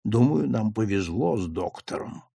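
hushed, confidential undertone; whispered aside. A narrator's reading. Officer confides in the narrator about the doctor, in a hushed whisper. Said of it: "Старший офицер Долан сказал мне шёпотом".

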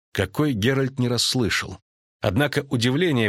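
usual speaking voice

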